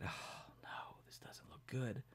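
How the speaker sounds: hushed, breathy alarm; worried whisper. A narrator's reading. Mike whispers to the others, worried. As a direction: whisper it, scared of the fire. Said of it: "Mike said".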